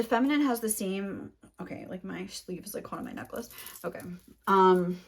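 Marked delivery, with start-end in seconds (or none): 1.6-4.0 much softer, half-whispered aside to herself; muttered aside. Real, not acted. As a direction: drop out of the reading to fuss with a snagged sleeve. Aside while fixing her sleeve.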